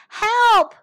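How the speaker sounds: high, very loud yell; crying for help. A narrator's reading. Hedgehogs to all as developers arrive, crying out. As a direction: belt out an urgent call for rescue. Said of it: "cried the hedgehogs".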